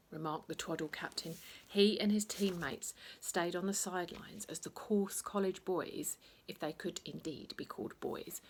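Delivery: plain reading voice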